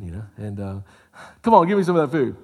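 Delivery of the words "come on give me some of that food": a touch louder, mildly begging; pleading Esau. Acted. Esau asking his brother for the soup, in a mildly pleading voice. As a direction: ask for the food half-heartedly.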